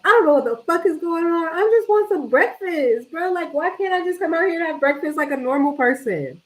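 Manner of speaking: high-pitched, exasperated inner rant. Retold or imitated. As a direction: vent like you're fed up and baffled. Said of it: "I'm like".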